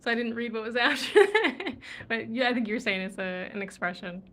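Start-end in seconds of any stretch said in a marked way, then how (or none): none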